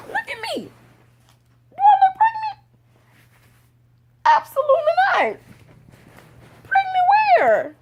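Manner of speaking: very high, incredulous squeal; squealed disbelief. Retold or imitated. Woman denying she could be pregnant, very high mock-incredulous squeal. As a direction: protest in a hammed-up squeaky voice.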